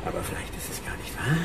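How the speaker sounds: schnelles stimmloses Flüstern; geflüstert. Acted. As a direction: zisch es rasch hinterher. Gehässiges Flüstern mitten im Wahn. (schnelles gehässiges Geflüster)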